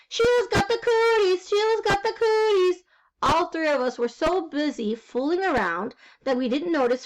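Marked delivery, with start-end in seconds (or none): 0.1-2.8 high playground chant, raised voice; hollered taunt. A narrator's reading. Two boys getting back at girl, hollering high. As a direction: holler it as a teasing singsong. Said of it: "We hollered really loud".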